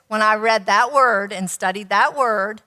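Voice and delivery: ordinary delivery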